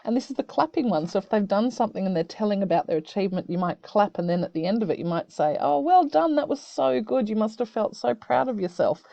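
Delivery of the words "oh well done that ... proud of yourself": bright praise settling down; encouraging. Shown as a demonstration. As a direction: warmly cheer an achievement. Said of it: "really nice for people to hear".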